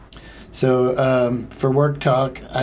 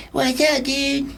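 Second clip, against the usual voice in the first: high-pitched cartoon voice; put-on voice pitched way up; character impression; 13 semitones higher, much slower than usual. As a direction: do a high, silly cartoon greeting. Opens with Cartman impression attempt.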